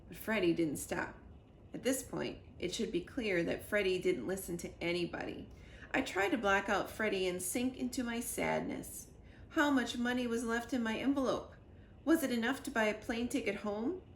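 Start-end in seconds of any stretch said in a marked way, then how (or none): none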